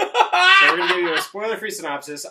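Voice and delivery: high, loud, laughing through words. Real, not acted. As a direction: burst out laughing mid-sentence. Laughing restart of intro.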